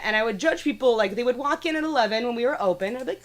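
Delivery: ordinary talk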